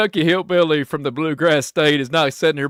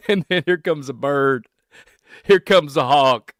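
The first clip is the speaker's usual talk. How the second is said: ordinary delivery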